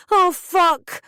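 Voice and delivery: high, loud panicked shout. A narrator's reading. Girl fleeing her furious mother, high panicked shout. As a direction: yell it as you bolt. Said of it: "I shouted".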